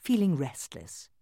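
usual speaking voice

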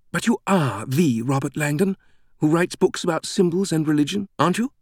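high, eager British fan's voice; star-struck British woman. A narrator's reading. British greeter recognizing the famous author, high and eager. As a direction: gush as you check it's really him.

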